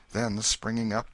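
ordinary delivery

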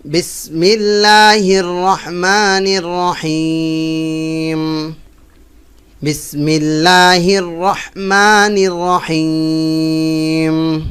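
slow, melodic chanted recitation; tajweed-style chant; Quranic recitation. Shown as a demonstration. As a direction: recite slowly with a drawn-out tune.

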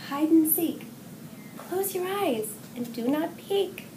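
ordinary delivery

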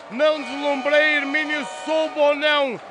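ordinary delivery

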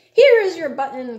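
high, loud call of discovery; excited cry. A narrator's reading. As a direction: cry out triumphantly to a friend. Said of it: "cried Frog".

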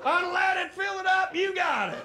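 eager Southern gas station guy; Southern accent. Retold or imitated. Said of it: "the guy from the gas station".

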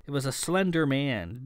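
ordinary delivery

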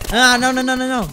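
high, loud rush of alarm; panicked yelling. Real, not acted. Player trying to stop an attacker, panicked yelling. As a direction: cry out repeatedly in real panic.

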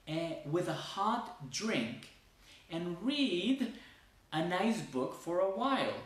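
slow, high, careful read-aloud; slow English reading. Shown as a demonstration. Teacher reading paragraph to Spanish-speaking students, slow and clear. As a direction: read each phrase slowly for learners.

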